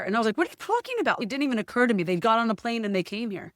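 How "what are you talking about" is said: much higher, incredulous question; stunned disbelief. Retold or imitated. To her parents on the phone, stunned disbelief. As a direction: ask it as if you can't believe it.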